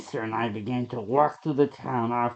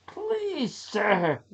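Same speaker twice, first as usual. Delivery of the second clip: much higher, pleading woman's voice; Lisa pleading; 9 semitones higher than usual. Acted. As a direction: beg him as a frightened woman. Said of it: "Lisa said".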